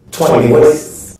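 big loud shout. Acted. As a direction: belt it out. Punchline shouted.

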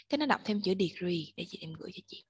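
usual speaking voice

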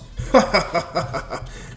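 breathy taunting cackle; mocking laugh. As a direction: laugh at your rival's misfortune. Vegeta laughing at Goku.

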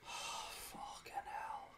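slow, whispered curse; despairing whisper. Real, not acted. Gamer muttering after another mistake, despairing whisper. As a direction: breathe it out defeated.

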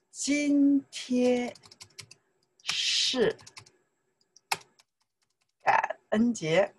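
drawn-out words with long pauses; slow model sentence. Shown as a demonstration. Teacher repeating for learners, slowly in separate chunks.

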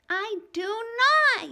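loud, high, slow yell. Acted. Ramona yells back at Howie, furious. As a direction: yell it back in full fury. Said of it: "yelled Ramona".

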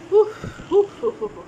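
short hot breaths; panting from spice. Real, not acted. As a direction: blow out the heat. Panting from spicy food.

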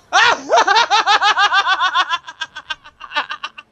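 long, loud, high-pitched fit of laughter. Real, not acted. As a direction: lose it completely. Sustained laughter.

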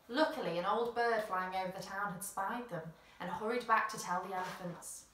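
ordinary delivery, nothing marked

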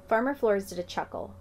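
ordinary delivery

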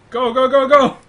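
loud, high battle cry; urgent rallying shout. Real, not acted. Player urges the platoon to charge, rallying shout. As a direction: yell them forward, breaking into laughter.

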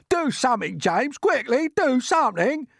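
high-pitched, urgent pleading; panicked cry. A narrator's reading. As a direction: beg frantically as a frightened earthworm. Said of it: "cried the earthworm".

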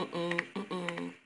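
ordinary talk

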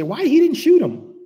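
high, loud and slow outraged question; indignant outcry. Retold or imitated. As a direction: demand it in disbelief. People reacting to mass shooters.

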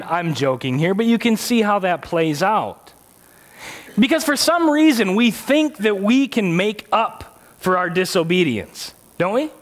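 normal talking voice

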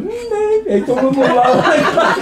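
high, giggling no; laughing denial. Retold or imitated. (naurava kieltäminen)

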